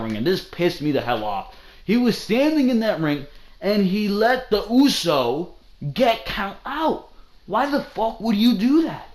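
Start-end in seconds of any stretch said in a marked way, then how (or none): 1.8-7.1 high, exasperated ranting; can't believe what he saw; indignant, higher-pitched complaint; pissed off. Real, not acted. Rant continues, pissed off. 7.5-9.1 high, incredulous demand; why would anyone do that; exasperated, raised rant; angry disbelief. Real, not acted. Angry rhetorical question.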